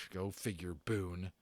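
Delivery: ordinary delivery, nothing marked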